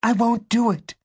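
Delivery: put-on very high, strained girl's refusal; defiant refusal. A narrator's reading. Angie refuses under pressure.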